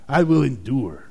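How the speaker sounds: a gritted, determined mock vow; mock heroic vow. Pastor mocking a heroic vow of endurance. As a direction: play the tough guy vowing to endure. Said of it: "we think about endurance as".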